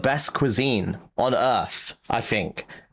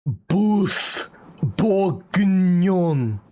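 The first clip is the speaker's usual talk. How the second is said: mock French accent; 4 semitones higher, much slower than usual. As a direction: roll boeuf bourguignon out like a fake chef. Reading French dishes aloud.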